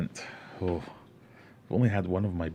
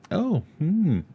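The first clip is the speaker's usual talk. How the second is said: high, noncommittal "Oh, hmm"; dismissive hmm; 12 semitones higher, 6 dB louder than usual. Retold or imitated. Advice on ignoring someone, mock noncommittal hmm. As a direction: feign polite interest, then drop it. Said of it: "it's just best to go".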